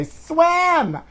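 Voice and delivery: a sudden high bark of an order; mock poolside command, pitched high; mom yelling. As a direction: imitate a pushy mother at the pool. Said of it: "and say".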